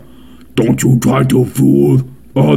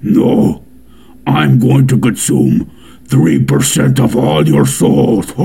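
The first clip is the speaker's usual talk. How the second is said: sinister creature with an evil chuckle; villainous virus voice ending in a dark laugh. Acted. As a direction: threaten like a soul-eating germ. Holds the virus voice.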